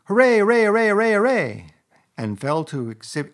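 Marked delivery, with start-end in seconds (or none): 0.0-1.7 very high, loud run of cheers; wildly delighted rat shouting; jubilant cheering. A narrator's reading. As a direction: cheer over and over without a break. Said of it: "Suddenly the rat cried".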